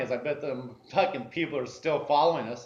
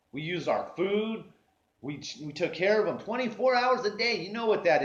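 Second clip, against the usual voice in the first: angry rant; 5 semitones higher than usual. Real, not acted. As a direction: let the grudge boil over. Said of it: "I'd go from mad to happy".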